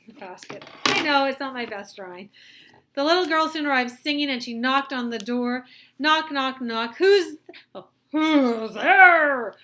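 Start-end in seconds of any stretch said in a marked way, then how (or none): 3.0-5.6 lively read-aloud storytelling; bright storybook narration; story narration. A narrator's reading. Teacher reads story aloud. 6.0-7.0 loud spoken knocks; loud, playful spoken knocking. Acted. Knocking at the door. 7.0-9.5 loud, high wolf disguised as granny; loud, high big-bad-wolf voice. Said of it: "asked the wolf in his big voice".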